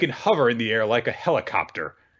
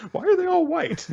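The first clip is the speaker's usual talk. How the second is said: laughing. Real, not acted. Laughing question about Slytherin.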